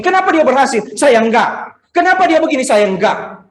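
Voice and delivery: acted loud, high-pitched protest of unfairness; envious complaint. Believer asking God why others succeed, mock envious outcry. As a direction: play an aggrieved, jealous complainer. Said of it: "Pantas nggak bilang ini".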